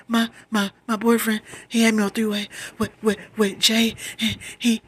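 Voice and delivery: high, loud stuttering rush; acted panic. Telling Ma about the three-way call, acted panic. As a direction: blurt it out in panicked fragments. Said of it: "I am having a panic attack".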